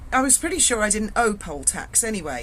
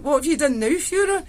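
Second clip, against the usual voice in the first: wee Scottish matron; 7 semitones higher, 4 dB louder than usual. Retold or imitated. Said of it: "she went".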